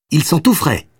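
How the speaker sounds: put-on high, proud little boy; proud child. A narrator's reading. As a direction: show off your catch like a kid. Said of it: "très fier de ses deux prises". (enfant fier de sa prise)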